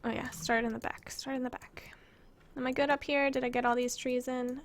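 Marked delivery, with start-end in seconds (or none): 1.1-1.9 whispered to herself; whispering. Real, not acted. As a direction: breathe it under your breath. Repeats it to herself.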